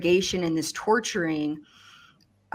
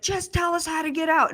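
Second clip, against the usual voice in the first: retold frantic yell; 10 semitones higher than usual. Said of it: "we're kinda yelling and screaming at each other".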